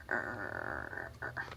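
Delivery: soft whispered leopard snarl; breathy cat snarl, unvoiced; snarl demo. Shown as a demonstration. Teacher showing kids a snarl, whispered. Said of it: "it's kind of like".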